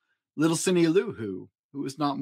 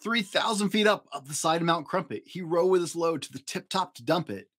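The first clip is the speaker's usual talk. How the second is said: plain reading voice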